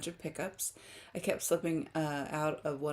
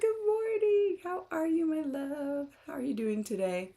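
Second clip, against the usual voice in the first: much higher, affectionate hello; sweet greeting; 10 semitones higher than usual. Real, not acted. Welcoming Kendra to the stream, higher sweet greeting. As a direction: greet her like a dear friend.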